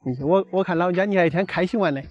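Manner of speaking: ordinary talk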